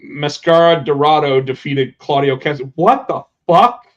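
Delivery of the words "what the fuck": slow, louder, incredulous outburst; stunned disbelief. Real, not acted. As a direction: blurt it out in shock, stretching the words. Reacts to a surprising result just read.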